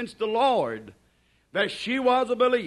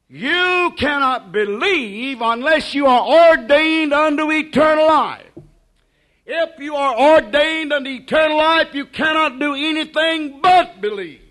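fiery preaching; 4 semitones higher, 8 dB louder than usual. Acted. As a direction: lift the congregation with it. Preaching at full force.

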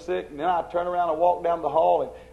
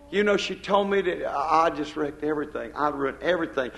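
ordinary delivery

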